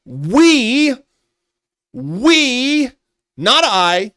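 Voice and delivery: high, loud mock-sinister voice; mock menacing villain. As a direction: ham up the threat like a cartoon villain. Reading out the troll's threat.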